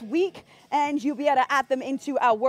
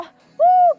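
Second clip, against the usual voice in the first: high whoop; 12 dB louder than usual. Real, not acted. Fitness cheer mid-workout.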